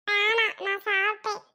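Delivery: put-on young boy turning down food; little boy. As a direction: play the little brother saying no thanks. Little brother refuses food.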